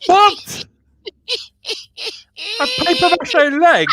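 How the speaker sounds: high-pitched, astonished disbelief; incredulous. Real, not acted. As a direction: react in amused, squeaking disbelief. Incredulous listener amid shared laughter.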